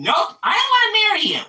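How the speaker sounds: high-pitched mock refusal; squeaky, quick put-on voice turning down a suitor. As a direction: imitate a bride-to-be in falsetto, rattled off fast. Acting out MJ dumping her fiancé.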